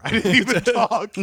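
breaking into laughter mid-sentence; laughing. Real, not acted. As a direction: laugh right through the words. Laughing at his own story.

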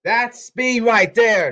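very loud, high, stretched words; shouted boast. Shown as a demonstration. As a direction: holler it slowly at full volume. Showing off speed to viewers.

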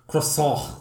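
"croissant" with a throaty French r and no final t; over-Frenchify "croissant", swallowing the ending; exaggerated French pronunciation. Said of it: "go out of her way to pronounce it like".

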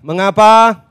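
loud, measured scripture recitation; leading unison reading. Real, not acted. Preacher leads congregation reading Lukas, loud and measured. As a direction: read slowly and loudly in separated phrases. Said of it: "Ya, sama-sama. Dua, tiga".

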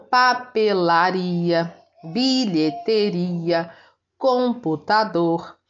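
papelaria, bilheteria, computador drawn out; slow careful examples. Shown as a demonstration. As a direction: say each long word slowly. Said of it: "teacher models example words".

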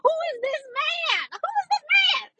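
very high-pitched, swooning disbelief; excited squeal. Retold or imitated. Telling viewers about his handsome outfit, excited squeal. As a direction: squeal it in delighted astonishment.

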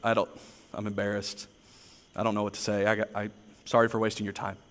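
quietly apologizing, deflated and embarrassed; embarrassed apology. Retold or imitated. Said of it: "and I was like".

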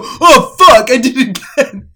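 loud mock frustrated curse; Zoe. Classmate realising she breathed there, shouted frustration. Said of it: "And she'd go".

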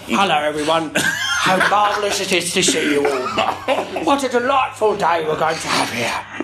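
a lightly put-on gentleman voice, laughing through it; bad Bram impression. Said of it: "I know my impression's shit".

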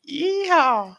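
high whooping yeehaw; cowboy yeehaw. Pretend. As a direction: yell it like a rodeo cowboy. Cowboy yell.

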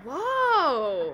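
loud startled whoa; stunned gasp of disbelief; shocked whoa. Real, not acted. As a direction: blurt it out in shock. Reacting to abuse allegation.

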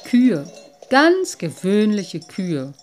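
a lightly voiced little water sprite, deflated; disappointed. A narrator's reading. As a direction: drag out the ordinary cows in mild disappointment. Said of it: "dachte der kleine Wassermann".

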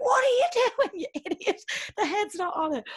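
high-pitched incredulous scolding; mock outrage. Irish drinker scolding Aussie over an unsettled Guinness, mock outrage. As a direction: scold the fool, half laughing.

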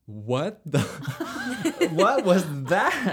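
high, loud, laughing disbelief; shocked laughing teacher. Retold or imitated. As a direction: gasp it out through laughter, stunned. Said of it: "our teacher was like".

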